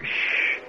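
long unvoiced shush; hushing shh. Preacher quieting Bert mid-service, hushing shh. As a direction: hush someone with a soft hiss.

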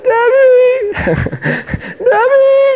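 very high, loud joyful squeal; ecstatic shouting. Retold or imitated. Healed husband phoning his wife, ecstatic high shout. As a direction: shriek the name in overjoyed excitement.

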